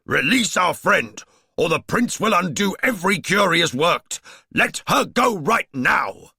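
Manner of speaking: loud, high, forceful demand; bellowing. A narrator's reading. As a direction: shout the ultimatum across the room. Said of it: "he bellowed".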